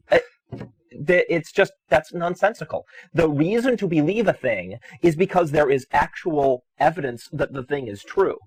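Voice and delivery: ordinary delivery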